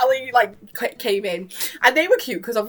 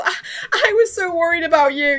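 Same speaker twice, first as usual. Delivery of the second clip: tearful relief; 9 semitones higher, 5 dB louder than usual. Retold or imitated. Adrian hugging Marinette.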